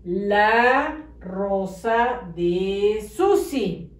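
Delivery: "La rosa de Susi" read very slowly and clearly; slow careful reading. Shown as a demonstration. Teacher reading back the corrected sentence slowly. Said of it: "quedó bien escrito".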